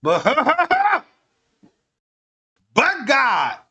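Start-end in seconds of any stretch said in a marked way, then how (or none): 0.3-1.0 a real laugh breaking out; laughing at his own mock testimony; burst of laughter. Real, not acted. Laugh after mock plea.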